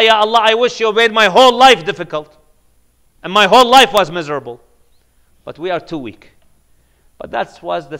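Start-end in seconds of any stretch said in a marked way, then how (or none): 0.0-4.6 louder, fast, pleading cry to God; fervent plea. Acted. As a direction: beg it out in a rush of longing. Preacher voices the believer's plea.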